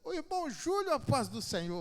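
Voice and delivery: high, loud churchy greeting; a woman calling out a loud, high greeting. Retold or imitated. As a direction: call out sweetly as the sister greeting him. Woman greets him loudly at church.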